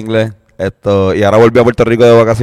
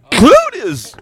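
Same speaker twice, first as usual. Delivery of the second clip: exclamación muy aguda y alargada; asombro fingido. Pretend. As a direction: chilla el nombre con sorpresa exagerada. Asombro fingido en charla en inglés. (falso entusiasmo agudo)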